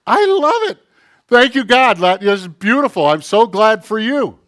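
mock delight. Congratulating a friend on a new boat, in loud, gushing mock joy. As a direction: overplay being thrilled for them.

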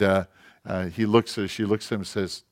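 ordinary delivery

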